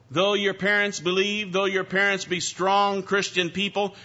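emphatic sermon appeal; preaching. Acted. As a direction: urge each listener toward personal faith.

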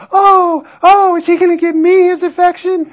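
high, loud, excited mock plea; eager relatives. Relatives watching the baby approach each chair, eager and hopeful. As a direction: act out doting relatives hoping to be picked. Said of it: "they're like".

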